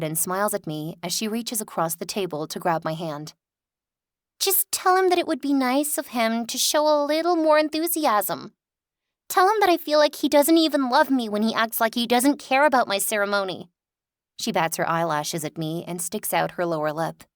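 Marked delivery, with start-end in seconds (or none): 4.4-13.7 high, loud whiny complaint; whiny spoiled sister. Acted. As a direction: whine like a pouting child. Lily whining complaints.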